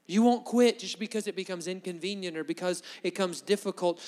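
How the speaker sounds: normal talking voice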